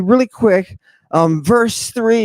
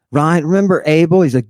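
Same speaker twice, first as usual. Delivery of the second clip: ordinary talk, nothing marked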